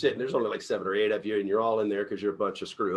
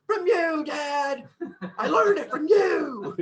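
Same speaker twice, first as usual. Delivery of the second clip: put-on high, slow, plaintive kid voice; kid's reply; 5 dB louder, much slower than usual. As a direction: play the wounded child answering his dad. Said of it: "And the kid goes".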